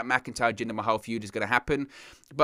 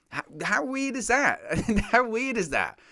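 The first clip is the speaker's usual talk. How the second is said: incredulous; 10 semitones higher than usual. Real, not acted. Incredulous at silly booking.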